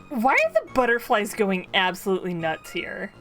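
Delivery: normal talking voice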